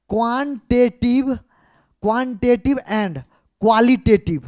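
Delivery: quantitative and qualitative slowly broken into syllables; syllable-by-syllable emphasis. Shown as a demonstration. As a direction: spell out quantitative, qualitative in slow chunks. Teacher dictating key terms for emphasis.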